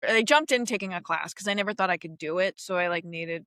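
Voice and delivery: ordinary talk, nothing marked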